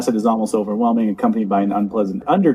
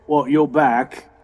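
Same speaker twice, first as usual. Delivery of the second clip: gang member; 3 semitones higher than usual. Acted. Lookout to a returning gang mate, gang member voice. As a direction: greet a returning crony and order them about, in character. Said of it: "and he says".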